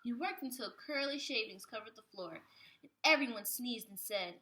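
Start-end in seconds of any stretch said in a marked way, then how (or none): none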